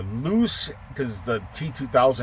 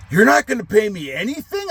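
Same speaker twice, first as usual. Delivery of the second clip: much higher whiny mock question; mocking his question; 9 semitones higher, 3 dB louder than usual. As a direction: mimic the guy's indignant complaint. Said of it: "funny, his response".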